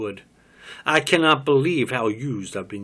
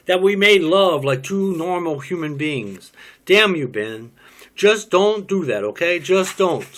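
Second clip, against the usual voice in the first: angry outburst; 6 semitones higher, 6 dB louder than usual. Acted. Bitter quarrel with Ben.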